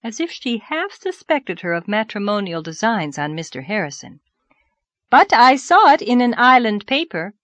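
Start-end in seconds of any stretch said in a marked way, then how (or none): 5.1-7.4 higher, louder insistence; persistent. A narrator's reading. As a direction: press the point, refusing to drop it. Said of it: "persisted the fair unknown".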